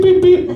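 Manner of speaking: high chirpy beep, imitating the iWatch; watch beep. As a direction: make it a tiny electronic alarm. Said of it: "decides to go beep".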